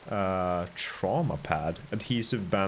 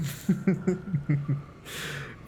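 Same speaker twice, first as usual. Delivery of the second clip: high shaky laugh after a fright; nervous laugh; 7 semitones higher than usual. Real, not acted. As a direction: laugh off the scare. Laughing after a jump scare.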